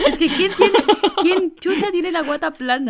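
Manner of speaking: high, loud, laughing indignation; laughing outburst. Real, not acted. To her friends about flat bellies, a laughing indignant outburst. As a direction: blurt it out in amused disbelief.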